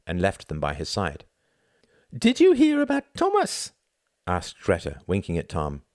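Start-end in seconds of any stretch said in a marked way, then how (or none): 2.1-3.7 gossipy woman's voice. A narrator's reading. Greta gossiping.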